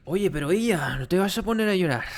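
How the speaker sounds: fast, loud mock-dramatic Spanish; playful Spanish. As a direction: rattle it off fast and loud, teasing. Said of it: "Cries in weave".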